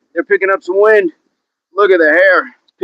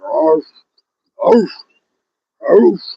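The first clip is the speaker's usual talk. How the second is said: spaced-out mock barks; dog bark; 4 semitones higher, much slower than usual. Playing a dog for the stream, barking. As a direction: bark like a dog, one arf at a time.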